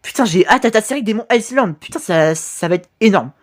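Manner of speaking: usual speaking voice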